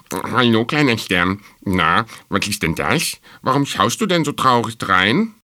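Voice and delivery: chirpy storybook squirrel greeting a friend; squirrel character. A narrator's reading.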